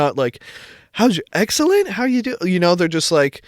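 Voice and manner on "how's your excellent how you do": high-pitched mock server greeting; fake-cheery waiter. Mimicking waiters who aren't real with you, fake-cheery. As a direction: imitate an overly chipper waiter, pitched way up.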